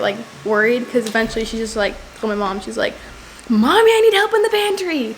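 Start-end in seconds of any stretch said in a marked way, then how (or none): 3.5-5.2 high, sustained call for mom; high-pitched singsong yell for a parent; imitated calling out. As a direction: imitate a kid hollering to another room. Voicing friend calling for mom.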